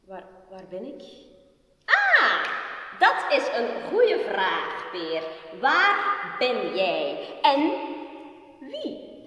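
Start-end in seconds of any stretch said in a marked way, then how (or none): 1.8-9.1 loud, high, eerie taunting voice; mocking voices in Peer's head jeering that his mother is dead; spiteful voices. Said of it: "praten tegen stemmen".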